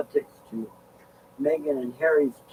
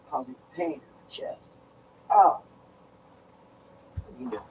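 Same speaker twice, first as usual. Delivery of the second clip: ordinary talk, nothing marked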